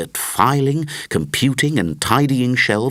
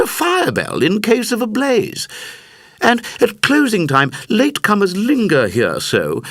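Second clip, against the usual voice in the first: librarian voice, higher and brighter; 8 semitones higher than usual. Acted. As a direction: speak up as the head librarian. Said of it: "the senior librarian hiccuped and said".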